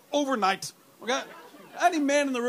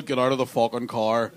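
mock hard man; 9 semitones lower, 4 dB louder than usual. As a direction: growl it like a gunman at a checkpoint. Mock gunman at a car.

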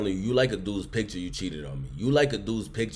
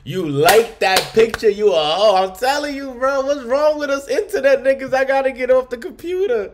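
high, loud, squealing rant; mock shriek; 8 dB louder than usual. Friend hamming up a jealous boyfriend, squealing high and loud. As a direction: squeal it like a scorned boyfriend losing it. Mocking angry boyfriend, laughing.

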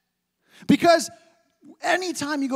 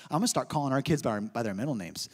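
low, fast, teasing quip; husband teasing wife, fast and low; 9 semitones lower, 5 dB quieter, faster than usual. Retold or imitated. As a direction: mess with your wife, fast and sly. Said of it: "I'm gonna say".

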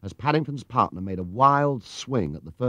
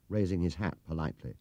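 plain reading voice

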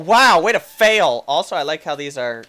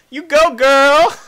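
loud, high, slow cheer; 9 semitones higher, 9 dB louder, slower than usual. Real, not acted. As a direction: cheer her on loudly. Cheering on the character.

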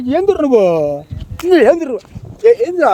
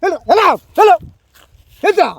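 high, repeated orders; angry dismissal; 6 semitones higher than usual. Real, not acted. Angry man ordering the stranger to leave, agitated. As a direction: drive him off with raised, agitated pitch. Driving the prankster away.

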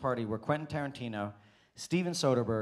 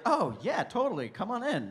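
high-pitched mock agreement, swooping up; imitated eager welcome; 8 semitones higher than usual. Directors letting the waiter into their circle, imitated high eager welcome. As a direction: play them gladly waving someone in, pitched well up.

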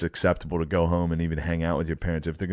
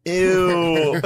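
loud, high drawn-out ew; grossed-out teenage chorus, hammed up; disgusted girls; 9 semitones higher, 5 dB louder than usual. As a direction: recoil in mock disgust.

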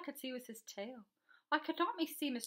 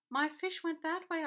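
higher, louder exclamation; excited storybook boy; urgent report; 6 semitones higher, 6 dB louder than usual. A narrator's reading. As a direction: point the rescuer the way, urgently. Boy directs the arriving policeman.

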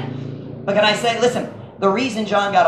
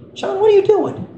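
mock alarmed high-pitched question; 14 semitones higher than usual. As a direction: caught off guard by a friend. Alarmed at John's pliers.

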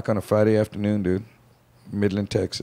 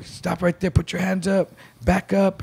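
higher barked commands; gunman orders; 7 semitones higher than usual. Retold or imitated. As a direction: order them back step by step.

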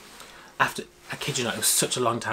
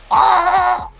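much higher, much louder shriek; excited shriek; 13 dB louder than usual. Retold or imitated. Fan realizing they are heading to the stage, shrieking. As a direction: shriek with joy. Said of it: "And I was like".

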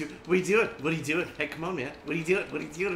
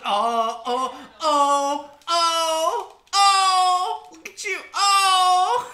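imitating a tired opponent's groans, slowing at the end; mock straining whimper; 4 semitones higher, much slower than usual. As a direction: whimper and strain like he's losing the arm wrestle. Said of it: "He's like".

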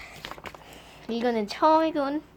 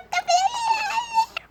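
startled scream; 3 dB quieter, slower than usual. Acted. Sudden fright.

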